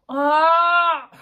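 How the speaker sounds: long, loud, high "aah"; sudden scream. Real, not acted. Vlogger doing her lips on camera, sudden scream. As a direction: burst out in a sustained high scream.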